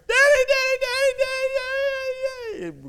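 put-on high, loud wailing little boy; little son running in, screaming and crying for dad; screaming child. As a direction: cry for daddy like a hurt four-year-old. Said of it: "Brandon comes in screaming".

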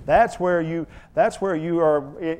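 ordinary talk, nothing marked

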